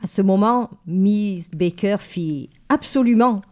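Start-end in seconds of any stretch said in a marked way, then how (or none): 2.7-3.4 abrupt, louder and higher single word; startling sudden interjection; sudden outburst. Acted. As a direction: blurt it out of nowhere. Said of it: "avec une telle soudaineté que je sursautai".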